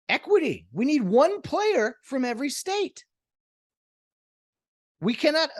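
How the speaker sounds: high, sing-song mock announcement; high mocking policy voice; mock earnest voice. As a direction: parody a diversity slogan.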